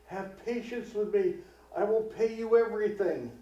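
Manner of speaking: high, urgent pleading for mercy; a servant pleading to be spared, voice lifted high; imploring. Acted. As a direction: beg the master on your knees. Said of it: "imploring him".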